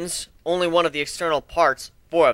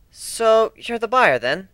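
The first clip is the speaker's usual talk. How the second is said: higher, louder, puzzled questioning voice; curious Twilight; 7 semitones higher, 4 dB louder, slower than usual. Acted. Twilight quizzes Griff about buying the ship, curious and suspicious. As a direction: size up the stranger with suspicion.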